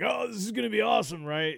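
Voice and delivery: hyped-up spectator; eager anticipation. Retold or imitated. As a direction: quote yourself giddy with anticipation, talking fast. Acts out his own eager watching.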